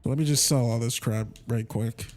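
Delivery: usual speaking voice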